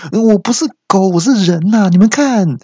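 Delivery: high, flustered comic denial; hurried protest. Acted. Man in dog mask proving he's human, flustered. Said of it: "我又赶紧说".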